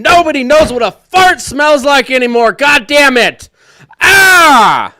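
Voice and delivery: escalating comic yell ending in a scream; mock furious shout. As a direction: blow up in a hammed-up rage over farts. Comic rant about lost smell.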